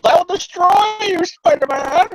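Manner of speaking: high, slow, loud hammed-up villain cry; mock goblin battle cry; villain threat. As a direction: bellow it like a cartoon supervillain. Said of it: "the Hobgoblin changes his mind and goes".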